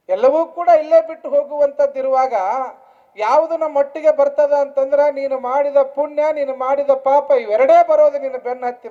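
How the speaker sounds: loud, projected moral exhortation; preaching. Real, not acted. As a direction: press the lesson on the congregation. Preacher at full force. (ಗಟ್ಟಿ ದನಿಯಲ್ಲಿ ಧರ್ಮೋಪದೇಶ)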